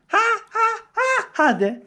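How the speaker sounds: high cackling evil laugh; ugly laugh. Shown as a demonstration. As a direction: cackle like a mad clown. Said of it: "Çirkin gül".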